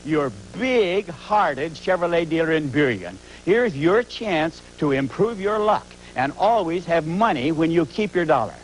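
loud hard-sell shout. Acted. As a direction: yell the pitch at the crowd. Shouted ad pitch.

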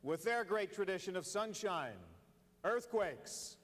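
drawn-out names like a stadium announcer; sports announcer intro. Acted. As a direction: boom the player names at a big game.

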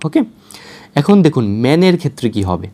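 ordinary talk, nothing marked

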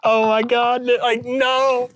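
high, loud laughing put-on voice; Rob Dyrdek impression. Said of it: "has a Rob Dyrdek impression".

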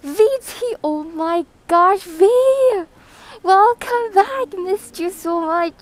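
high, loud, drawn-out joyful welcome; excited greeting. Real, not acted. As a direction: squeal with delight at a returning friend. Streamer greets returning viewer.

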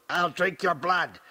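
possessed, menacing curse; hideous curse. A narrator's reading. Possessed Aaliyah cursing everyone in the room, raving. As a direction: spit out a raving threat. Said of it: "Hideous curses erupt from her".

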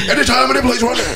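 wrestling promo. Pretend. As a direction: call him out like a pro wrestler. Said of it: "Let's just cut a wrestling promo".

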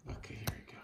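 soft whisper. Real, not acted. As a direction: say it under your breath. Dad filming quietly.

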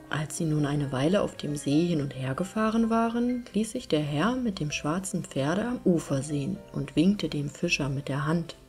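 plain reading voice

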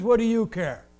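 curt, dismissive brush-off to a stranger; mock New York attitude, short and sharp; sarcastic New Yorker snap. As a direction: toss it back like a put-out city local. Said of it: "This is how they are".

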